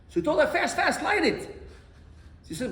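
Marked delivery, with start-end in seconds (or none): 0.5-1.6 high, hurried retold command; urgent order. Father telling his daughter to light it, urgent order. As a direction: hurry her along, pitched up.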